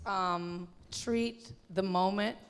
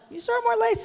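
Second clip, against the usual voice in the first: much higher, incredulous question; doubtful surprise; 12 semitones higher, 5 dB louder than usual. Retold or imitated. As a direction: ask it in high disbelief. Her doubtful reply to designer.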